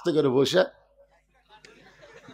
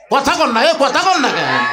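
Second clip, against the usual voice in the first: high, insistent shout to the crowd; calling for response; 8 semitones higher, 5 dB louder than usual. Real, not acted. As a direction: demand an answer from the audience. Calls on audience to respond. (উঁচু গলায় শ্রোতাদের হাঁক)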